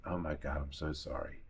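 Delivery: low, hushed condolence; retold sympathy. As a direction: console them softly, voice dropped low. Retold sympathy for upset appraiser.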